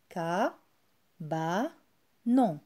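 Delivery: "Ka, ba, non" spoken as three separate beats; syllable by syllable. Shown as a demonstration. Teacher counts out the syllables of cabanon for pupils. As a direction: break the word into three clear syllables. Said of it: "un mot qui contient trois syllabes".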